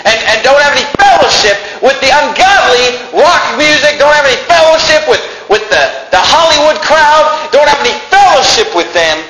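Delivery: high, loud pulpit denunciation; full-force preaching. Real, not acted. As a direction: hammer each warning at the congregation.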